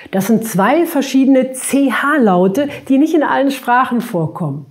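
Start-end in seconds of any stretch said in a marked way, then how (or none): none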